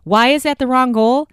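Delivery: ordinary talk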